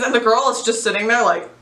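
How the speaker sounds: ordinary talk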